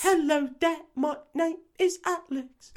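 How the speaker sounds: put-on very high, slow robotic British kid; squeaky robot boy imitation. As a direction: play a stiff little robot child, squeaky and slow. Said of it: "like they programmed a little robot boy".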